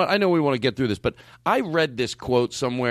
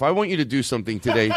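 usual speaking voice

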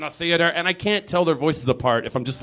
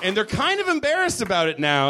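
ordinary talk, nothing marked

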